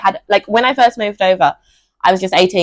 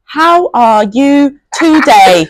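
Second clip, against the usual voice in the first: very slow and overdone, slightly higher; exaggerated slow English; 5 semitones higher, 3 dB louder, much slower than usual. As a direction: stretch each word for a foreigner. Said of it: "take it way too far".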